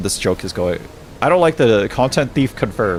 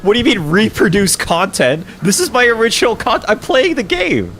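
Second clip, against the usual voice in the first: indignant protest; 11 semitones higher, 4 dB louder than usual. Real, not acted. Arguing with TikTok warning.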